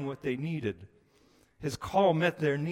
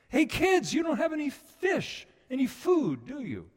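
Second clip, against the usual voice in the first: much higher, louder friendly call; calling to them; 13 semitones higher, 5 dB louder than usual. Retold or imitated. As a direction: call out to the tired boys, not yelling. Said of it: "he wasn't just yelling at them. He was calling".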